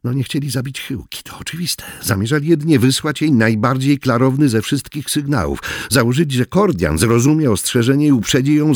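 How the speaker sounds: ordinary delivery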